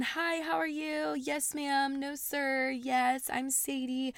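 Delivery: high, soft sing-song greeting voice; smiley, bubbly politeness. Retold or imitated. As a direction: put on a sweet, eager-to-please smile. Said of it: "I try and be very smiley".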